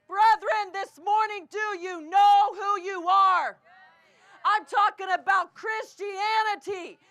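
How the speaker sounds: slow, loud, rising preacher cadence; full-force preaching. Acted. Preacher to the brethren, slow and loud. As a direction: hammer each line home from the pulpit.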